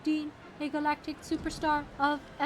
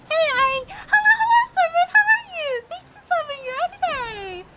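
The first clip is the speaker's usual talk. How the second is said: much higher and louder greeting; high pitched voice; 10 semitones higher, 9 dB louder than usual. Real, not acted. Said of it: "You have a higher pitched voice".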